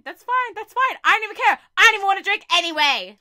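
"fine" shouted, then a fast angry finish; bitter escalating rant. Acted. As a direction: spiral from fake calm into an outburst.

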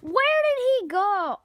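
loud, slow, high call. Acted. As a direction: call out looking around. Searching loudly.

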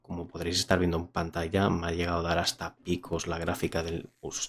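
voz de presentador, pausada; reseña a cámara. Acted. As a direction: cierra el vídeo con la opinión. Closing review to camera. (tono de reseña)

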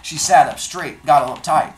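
reading a novel aloud to the class; steady storytelling read, lines brought to life; teacher reading a chapter to students; story narration. A narrator's reading.

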